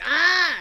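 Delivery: short loud high "Ah!" of pure excitement; loud, high burst of joy; excited yell. Shown as a demonstration. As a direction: let out an all caps shout of glee. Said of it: "I'm all like".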